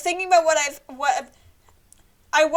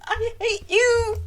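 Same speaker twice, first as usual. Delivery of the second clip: mock hatred; 7 semitones higher, 6 dB louder, slower than usual. Teasing game character.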